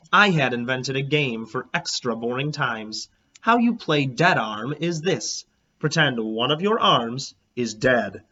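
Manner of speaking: plain reading voice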